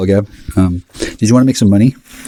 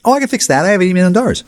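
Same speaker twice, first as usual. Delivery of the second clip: much higher, mocking woman's voice; imitating her; 14 semitones higher, faster than usual. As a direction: squeak her offer in a falsetto mockery. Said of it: "she'd be like".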